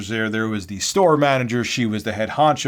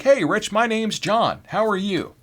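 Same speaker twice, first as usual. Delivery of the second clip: high, bright-pitched casual hello; mock a normal friendly greeting; 10 semitones higher than usual. Coworker greeting the new hire, high and friendly. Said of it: "most people would just be like".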